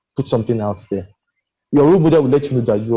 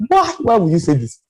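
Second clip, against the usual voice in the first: exasperated disbelief; 7 semitones higher than usual. Retold or imitated. Exasperated reaction to someone's tweets.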